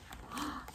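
breathy unvoiced gasp of wonder; a quiet gasp of wonder; soft gasp. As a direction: gasp in hushed amazement. Gasp at sleigh bells.

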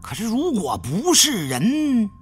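ordinary delivery

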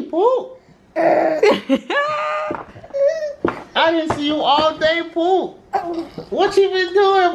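put-on very high, sing-song baby talk. Father greeting his baby son in very high baby talk. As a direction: coo up high at the little one.

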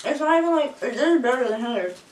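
talking with mouth full; chewing. Real, not acted. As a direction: hand over mouth. Seen 4: left woman covers mouth with hand, seems chewing caramel.

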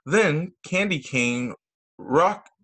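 usual speaking voice

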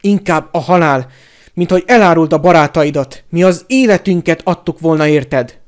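loud, impassioned bellow; shouted, indignant tirade; furious yell. A narrator's reading. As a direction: roar your outrage at the traitor.

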